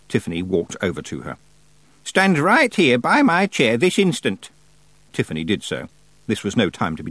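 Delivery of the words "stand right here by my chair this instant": put-on stern old witch. A narrator's reading. Miss Treason commands Tiffany.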